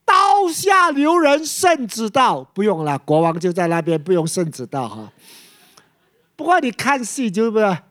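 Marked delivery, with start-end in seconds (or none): 0.0-2.4 calling out from afar. Acted. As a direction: shout it far higher and louder, like an opera herald. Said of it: "远远就有人在喊". (远远高声大喊，像戏里传圣旨)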